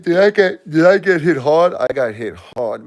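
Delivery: high and loud, breaking with grief; anguished cry. Real, not acted. Grieving son to viewers, crying out high and loud. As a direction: cry out at how hard it hit. Overwhelmed by wife and mom dying.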